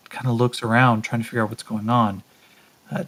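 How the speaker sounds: ordinary delivery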